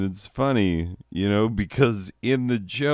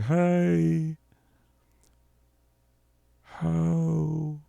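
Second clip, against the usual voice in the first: distant mock calls; 9 semitones higher, 5 dB quieter, much slower than usual. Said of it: "It's like".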